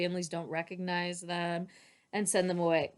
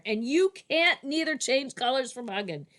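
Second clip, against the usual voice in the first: higher, louder acted indignation; angry child protest; 5 semitones higher, 4 dB louder than usual. Girl arguing with her teacher about hugging, angrily. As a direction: argue back as a cross little girl. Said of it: "glares at me angrier than I've ever seen her".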